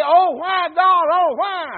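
loud, high preacher's lament; hammed-up wail of mourning; mock anguished cry. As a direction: cry out to God in acted grief. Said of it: "weep and mope and cry and, and say".

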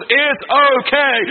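stretched out slow and lifted high; proclaiming it slowly to the congregation. Acted. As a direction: draw out every word for emphasis. Preacher climaxing on God's words.

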